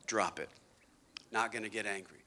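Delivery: quiet self-talk. Shown as a demonstration. Calming self, muttering.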